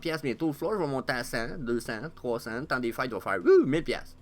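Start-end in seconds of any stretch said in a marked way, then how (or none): 3.4-4.2 loud, high mock price surge; sudden high, loud excitement; excited whoop. Imagines price jumping at Christmas.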